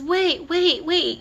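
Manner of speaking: mildly acted high-pitched plea to wait; calling after him. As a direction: imitate someone chasing after a friend. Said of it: "she chases him down and says".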